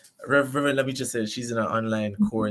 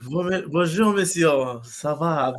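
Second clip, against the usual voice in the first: careful learner's French bonjour; trying French; 5 semitones higher than usual. Shown as a demonstration. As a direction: repeat the French greeting carefully.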